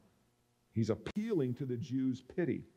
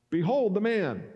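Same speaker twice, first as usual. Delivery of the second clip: louder, higher announcement; confident proclamation; 5 semitones higher, 5 dB louder than usual. Acted. Pilate sure this will satisfy the crowd, proclaiming loudly.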